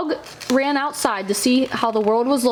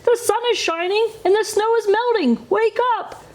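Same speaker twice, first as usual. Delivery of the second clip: higher, bright-eyed frog urging his friend; eager Frog voice; 10 semitones higher than usual. A narrator's reading. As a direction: coax a sleepy friend outdoors, much higher. Frog voice, urgent.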